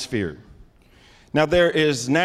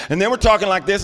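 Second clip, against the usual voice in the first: louder, raised voice; loud talk; 6 semitones higher, 5 dB louder, faster than usual. Shown as a demonstration. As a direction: crank it up into an argument.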